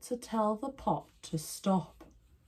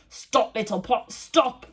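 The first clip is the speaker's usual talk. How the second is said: stern; 5 semitones higher, 5 dB louder, slower than usual. A narrator's reading. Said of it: "said sternly".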